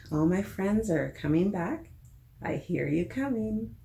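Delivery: usual speaking voice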